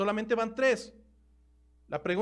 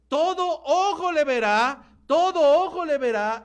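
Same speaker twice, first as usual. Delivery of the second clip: loud, slow, raised preaching voice; emphatic proclamation; 5 semitones higher, 6 dB louder than usual. Real, not acted. Preacher declares that every eye will see, emphatic proclamation. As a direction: proclaim it slowly to the whole congregation.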